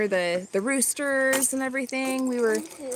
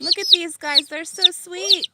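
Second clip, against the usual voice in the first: cooing; 7 semitones higher, 3 dB louder than usual. Real, not acted. Cooing over baby chicks.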